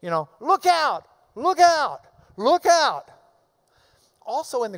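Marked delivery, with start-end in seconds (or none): 0.4-3.1 urgent repeated warning; triple warning. Acted. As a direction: look out thrice, each out loud and high. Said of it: "Paul's got emphasis there".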